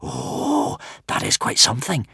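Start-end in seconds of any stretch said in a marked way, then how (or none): 0.0-0.8 lightly gasped wonder, starting high; impressed gasp. A narrator's reading. Crowd reacts to Hiccup's gift, gasping, impressed. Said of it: "gasped the crowd".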